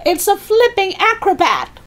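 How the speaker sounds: very high, amazed holler; shouting. Acted. Grandma marvels at the leaping hen, high shout. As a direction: exclaim it from the rooftop. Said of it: "Grandma shouted from the rooftop".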